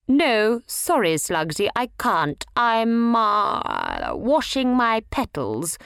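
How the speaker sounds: hesitant excuse with a stuck uh; stammering. A narrator's reading. Primrose turning Slugsy down, stammering an excuse. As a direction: fumble for a fake excuse, slowing at the end. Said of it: "she stammered".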